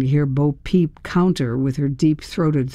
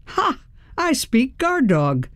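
triumphant; 9 semitones higher than usual. A narrator's reading. Triumph after scaring coyotes.

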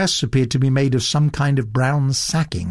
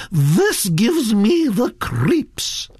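high, slow, swooping disgust; horrified grande dame voice; repelled Duchess; 10 semitones higher, much slower than usual. Acted. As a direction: draw it out in grand revulsion.

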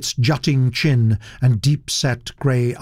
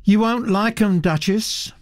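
high, casual warning; young woman's offhand caution; 9 semitones higher than usual. Acted. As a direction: lightly warn a grand lady off. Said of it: "she said, warningly".

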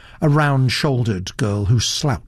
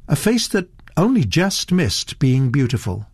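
ordinary delivery, nothing marked

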